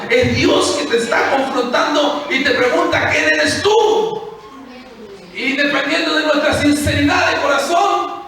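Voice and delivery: loud, high, drawn-out preaching shout; preaching climax. Acted. Preacher confronting the congregation, loud and soaring. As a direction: hit the peak of the sermon.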